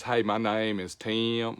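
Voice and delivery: slow Georgia drawl, Tim in extra syllables; Southern Georgia drawl, unhurried; Georgia accent. Acted. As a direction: play a Georgia girl introducing herself, stretching Tim. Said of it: "That's how they say it down in Georgia".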